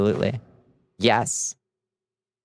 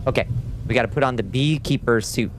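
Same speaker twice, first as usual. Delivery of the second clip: ordinary talk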